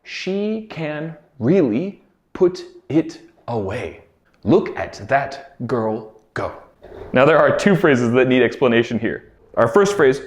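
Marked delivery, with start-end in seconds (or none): 0.0-6.5 slow, careful "She can really put it away"; slow clear model. Shown as a demonstration. Teacher slowly modelling the phrase for students. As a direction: spell it out slowly for learners. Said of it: "This phrase was".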